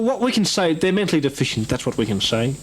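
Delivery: normal talking voice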